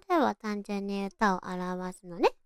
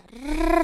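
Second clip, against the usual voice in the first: rolled r; 4 semitones higher, 4 dB louder than usual. Shown as a demonstration. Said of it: "お兄ちゃんとこうやって負け舌やって遊んでた".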